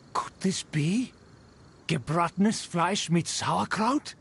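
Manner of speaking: breath-caught astonishment at a gift; awed surprise. Acted. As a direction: whisper it in disbelief. Noble marvels at the gift.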